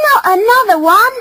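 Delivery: high, loud indignation; loud, high-pitched annoyance; angry cry. Acted. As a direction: protest as an exasperated young female. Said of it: "cried the female angrily".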